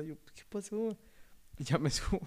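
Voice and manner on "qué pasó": put-on higher, innocent kid's reply. Boy answering his mother innocently, higher playing-dumb voice. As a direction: play dumb like a relaxed kid.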